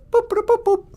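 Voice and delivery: very high mock computer beeps. Calling the spaceship computer with high mock beeps.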